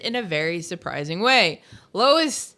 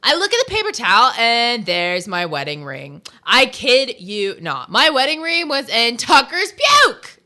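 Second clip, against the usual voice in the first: put-on Valley girl voice; 2 semitones higher, 6 dB louder than usual. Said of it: "I have to read this like a Valley girl".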